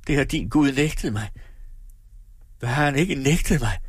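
understated anguish; intense whisper. A narrator's reading. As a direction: lament it with rising intensity. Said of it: "hviskede hun højere".

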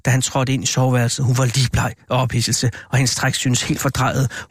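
plain reading voice

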